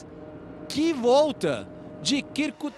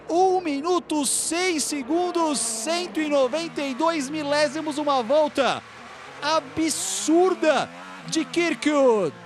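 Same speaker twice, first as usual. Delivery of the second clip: higher, drawn-out excited call; excited time call; 7 semitones higher, slower than usual. Acted. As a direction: stretch the numbers in awe. Announcing the pole time.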